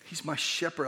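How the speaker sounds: ordinary delivery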